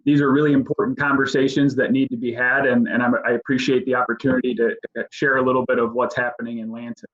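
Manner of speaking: quick, run-on delivery; fast talking. Real, not acted. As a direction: speak fast, barely pausing between phrases. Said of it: "I'm sorry, I do speak fast".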